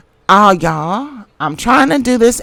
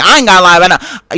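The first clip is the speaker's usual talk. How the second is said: ordinary delivery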